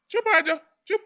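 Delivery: high, loud hush call; shout to hush. Acted. Father shouting at his quarrelling son, loud and high. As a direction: shut him up with a sharp loud call.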